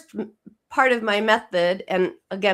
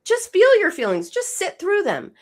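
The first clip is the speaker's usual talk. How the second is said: much higher, airy mock advice voice; mocking sing-song advice; 11 semitones higher, 4 dB louder than usual. Host mocks the "just feel it" advice in a high voice. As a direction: parrot the trendy advice in a high mocking lilt. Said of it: "but our culture also says".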